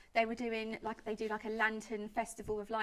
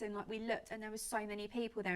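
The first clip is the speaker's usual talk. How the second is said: ordinary delivery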